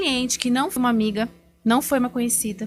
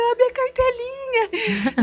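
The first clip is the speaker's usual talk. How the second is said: high, drawn-out tearful whine; hammed-up happy sob, stretched and high; mock crying; 13 semitones higher, 3 dB louder, much slower than usual. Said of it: "com a cartela e eu chorando". (chorinho fingido, agudo e arrastado)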